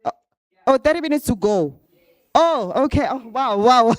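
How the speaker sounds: happy startled oh; surprised. Real, not acted. Told she has time left.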